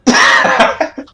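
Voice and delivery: high, loud call across the house; calling a servant. Acted. Noble girl yells for her servant Gregor: loud call. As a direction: shout for the servant, imperious and bright.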